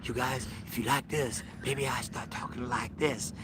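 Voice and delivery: a hushed, slowed put-on voice of the puppet man; imitating puppet guy. Vlogger mimics the puppet guy to crack up his wife. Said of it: "He talks like that".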